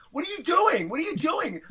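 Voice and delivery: high, loud panicked "what are you doing"; acting out alarm at a reckless friend; panicked protest. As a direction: voice jumps way up in mock panic. Alarmed at bold friend buzzing in.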